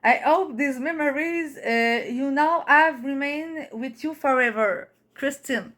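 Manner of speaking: slow, determined English with a Québécois accent; drawn-out French-accented English; French-Canadian accent. Real, not acted. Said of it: "Jugez pas mon accent".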